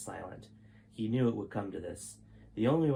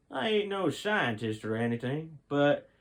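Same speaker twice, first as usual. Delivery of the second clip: lightly voiced drawling youth, a bit louder; a hint of a laid-back young man; 6 semitones higher, 4 dB louder than usual. A narrator's reading. Jack interrupting the general, a hint of casual youth. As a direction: speak up offhand, not a scientist.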